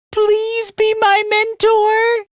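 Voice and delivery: high-pitched, drawn-out begging; earnest, squeaky appeal to a mentor; desperate plea. Acted. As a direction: plead slowly, voice pitched right up. Begs Takumi to be mentor.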